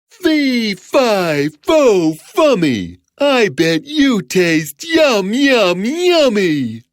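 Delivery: high, drawn-out hungry giant; gloating giant. Acted. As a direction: chant it hungrily as the giant kid. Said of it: "said the giant".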